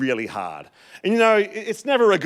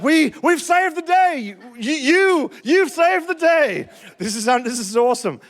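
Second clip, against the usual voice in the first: emotional joy; 11 semitones higher, 4 dB louder than usual. Retold or imitated. Husband thanking his wife for fixing it, overjoyed gush. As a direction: gush over her like she saved everything. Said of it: "I'm a bit of an emotional kind of guy".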